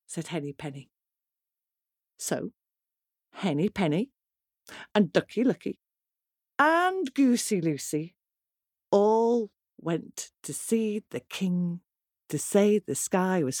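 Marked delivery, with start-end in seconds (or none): none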